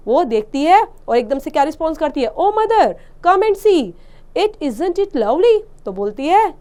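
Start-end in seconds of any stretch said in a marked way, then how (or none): 2.4-5.6 high, bright-eyed call to mother; a delighted little girl calling out; excited child. Acted. A delighted little girl calls her mother, excited.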